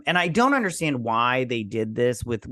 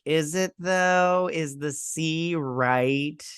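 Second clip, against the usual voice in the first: drawn-out, higher sceptical query; mock questioning; 5 semitones higher, much slower than usual. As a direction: stretch each word in exaggerated doubt. Mocking a repeated line.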